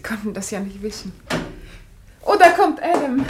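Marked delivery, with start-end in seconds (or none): 2.2-3.2 higher and much louder, announcing; light up and call it out; bright announcement. Acted. Hostess announcing her husband's arrival to guests, brightly.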